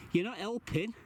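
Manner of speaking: high exasperated scolding; scolding the cat. Real, not acted. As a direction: tell the cat off.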